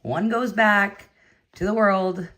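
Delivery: calm him down gently and slowly; a light imitation of her own soothing reply; retold reassurance. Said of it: "I was like".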